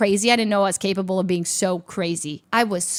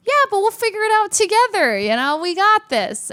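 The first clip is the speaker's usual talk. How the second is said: chirpy reassurance; 12 semitones higher, 5 dB louder than usual. Retold or imitated. Retelling own upbeat reply to friend.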